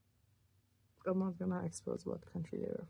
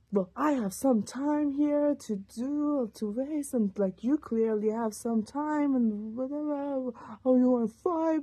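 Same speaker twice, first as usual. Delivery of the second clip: loud, higher, slowed mocking rant; retold yelling; 5 semitones higher, 8 dB louder than usual. Ex yelling down the phone, loud, high and mocking. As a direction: yell it up high to taunt her. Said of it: "just yelling".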